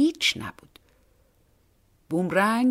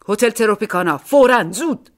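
much louder, hurried command; urgent order; 3 semitones higher, 8 dB louder than usual. A narrator's reading. Fleeing man orders the taxi driver, loud and urgent. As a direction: bark the hotel name, urgently.

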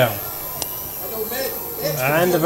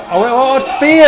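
sudden higher, louder gasp of surprise; surprised outburst; 7 semitones higher, 4 dB louder than usual. Real, not acted. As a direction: react as the move lands out of nowhere. Surprise spear move.

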